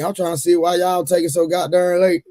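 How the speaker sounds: normal talking voice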